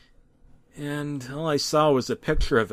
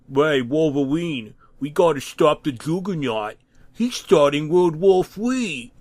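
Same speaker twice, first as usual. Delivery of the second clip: hammed-up video game professor voice; Doctor Light accent; 3 semitones higher, 4 dB louder than usual. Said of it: "In an accent reminiscent of Doctor Light from Mega Man eight".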